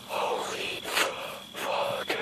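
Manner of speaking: breathless scared whisper; whispered curse in the dark; frightened whisper. Real, not acted. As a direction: hush it, terrified of being heard. Hiding, breathless.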